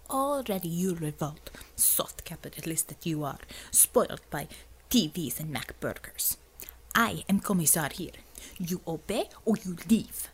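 put-on cold, clipped commissar voice with a Russian accent; icy Russian accent. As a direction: stare him down in a Russian accent. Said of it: "Her glare turned icy".

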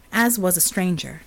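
usual speaking voice

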